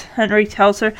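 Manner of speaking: ordinary delivery, nothing marked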